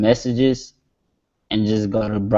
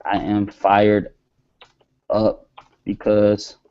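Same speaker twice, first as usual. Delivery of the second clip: typing it out word by word, slowly; slow dictation; much slower than usual. Shown as a demonstration. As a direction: read the line slowly as if typing it. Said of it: "I'm gonna say".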